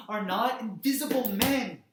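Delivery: loud, high ringing declaration; defiant cry. Acted. Activist insisting his forebears were not invisible, defiantly loud. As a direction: burst out in proud protest. Climax of roll call.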